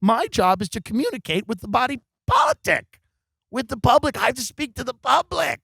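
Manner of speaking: high-pitched mock outrage; indignant outburst. Comedian defending his job to complainer, shrill indignant protest. Acted protest to man calling cops.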